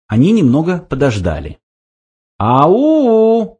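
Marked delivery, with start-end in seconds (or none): 2.4-3.6 long, high-pitched halloo; drawn-out hail, pitch way up; calling out. A narrator's reading. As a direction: call out to see if anyone answers. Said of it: "окликнул Форд".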